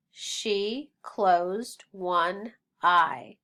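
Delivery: very slow, word-by-word reading; slow choral-reading lead; read-along pace. Shown as a demonstration. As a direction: space out each word for learners to follow. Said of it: "let's read the sentence".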